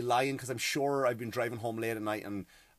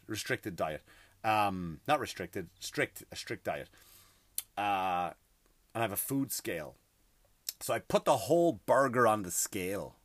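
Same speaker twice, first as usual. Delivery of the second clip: ordinary talk, nothing marked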